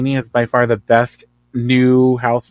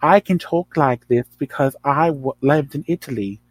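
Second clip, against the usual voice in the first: fake European accent; 2 semitones higher than usual. Mocking Dorit's posturing in a fake European accent. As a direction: put on a phony European accent to show off. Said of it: "fake European".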